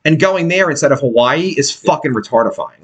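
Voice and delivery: ordinary delivery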